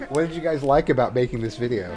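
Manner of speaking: ordinary delivery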